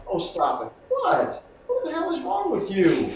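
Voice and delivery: high exasperated scold; scolding the dog. Shown as a demonstration. Scolding his dog over the shoe, high and exasperated. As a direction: snap at the dog from the gut. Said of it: "I'm coming from here".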